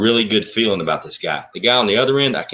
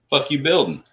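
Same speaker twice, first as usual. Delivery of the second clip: blunt challenge; 6 semitones higher than usual. Retold or imitated. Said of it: "There wasn't no niceties about it".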